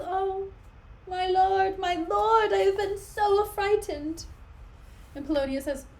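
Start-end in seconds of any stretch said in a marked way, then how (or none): 0.0-4.2 higher, louder, breathless fright; frightened Ophelia. Acted. Ophelia rushing to her father, high and frightened. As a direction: burst in terrified to your father.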